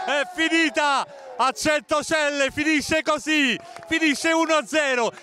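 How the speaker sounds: high, jubilant full-time call; excited final whistle call. Real, not acted. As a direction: celebrate the win on air.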